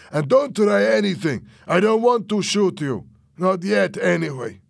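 high, loud, easing at the end; threatening warning. A narrator's reading. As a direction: warn him at gunpoint. Fernando threatens at gunpoint.

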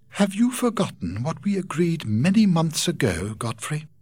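put-on young heroine questioning her cousin; Rachel, reproachful. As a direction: remind him of their pact, a little hurt. Rachel voiced in the story.

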